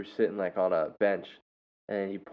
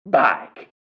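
big, loud, higher exclamation; mimicked prisoner shout; 4 semitones higher, 9 dB louder than usual. Teacher shows first grader the prisoner's way, loud mimicked shout. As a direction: belt it out to correct the kid. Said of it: "I say, "No, no, no, no, no".

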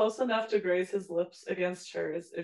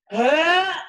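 disgusted shriek; 9 semitones higher, 10 dB louder than usual. Real, not acted. Cringing at creepy line.

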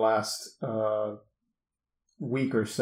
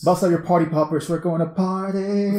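mock party hype; 5 semitones higher than usual. Co-host greeting the party games topic, mock party hype. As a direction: hype up the party, stretching the final word.

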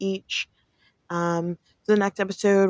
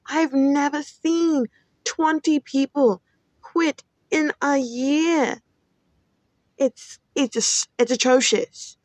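slow, higher, emphatic disbelief; exasperated rant; 6 semitones higher, slower than usual. Real, not acted. Venting to listeners about coworkers quitting, slow outraged disbelief. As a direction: drag it out, outraged at the numbers. Ranting about company turnover.

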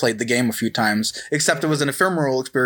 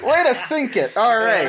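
higher, still laughing as you talk; 9 semitones higher than usual. Real, not acted. To cohost who blurted Undertale, laughing. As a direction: tease through a laugh.

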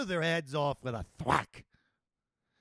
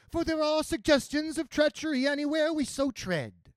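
very high-pitched nun giving a warning; Murta's character voice; 11 semitones higher than usual. A narrator's reading. Mercenary nun warning of treachery, very high voice. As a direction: hold the voice high throughout.

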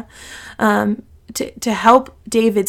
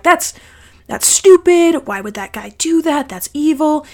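mad outburst; 5 semitones higher than usual. Retold or imitated. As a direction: spit out the outrage. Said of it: "he's mad".

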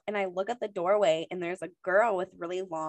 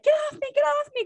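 much higher, louder, fast retold scream; panicked scream; 6 dB louder, faster than usual. Fighting off the ghost girl in the camp closet, panicked scream. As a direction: shriek it as the ghost swings at you. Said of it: "and I'm screaming".

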